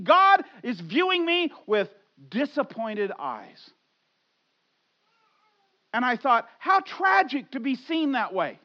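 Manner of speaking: loud, high preaching voice; impassioned preaching. Acted. Pastor confessing his old shame to the church, loud preaching. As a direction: pour it out like a confession from the pulpit.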